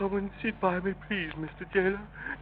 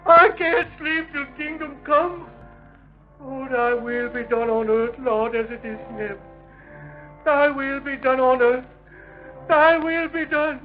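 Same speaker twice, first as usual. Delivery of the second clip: high, sobbing, breaking prayer; hysterical crying prayer; 11 semitones higher than usual. Acted. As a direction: wail the prayer, close to tears. Prisoner breaks down praying.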